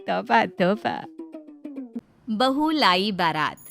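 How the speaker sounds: ordinary delivery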